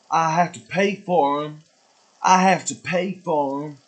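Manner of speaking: high, loud, put-on voice ranting about paying for grocery bags; imitating Northern Lion. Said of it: "That was me ... impersonation of Northern Lion".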